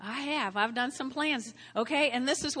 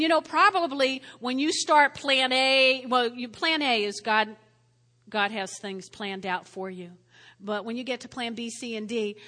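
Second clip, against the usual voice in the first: ordinary talk, nothing marked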